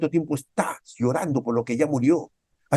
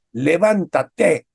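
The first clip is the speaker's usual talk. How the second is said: loud drawn-out command; 4 semitones higher, 6 dB louder, much slower than usual. Real, not acted. Preaching the word slowly.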